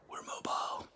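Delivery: whispered aside. Real, not acted. Starting a room tour for viewers, whispered. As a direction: whisper it to the camera. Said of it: "whisper heard".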